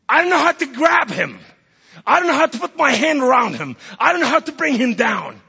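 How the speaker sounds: high, loud, insistent repetition; helpless outcry. Acted. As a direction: cry out at being utterly at a loss. Preacher's frustrated helplessness, retold.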